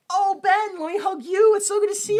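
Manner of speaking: high-pitched, effusive hostess greeting; gushing mother figure. Retold or imitated. Missus Storm welcoming Ben, gushing falsetto. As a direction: put on a high, loud fussing-over-you voice.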